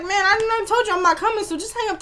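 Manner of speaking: high-pitched, put-on fed-up voice; mock exasperated refusal. Recapper imitating a man refusing to meet his caller, exasperated.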